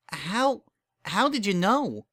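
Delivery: a touch of nervous surprise; startled nervous question. Acted. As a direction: ask in alarm. Bon Bon voiced higher.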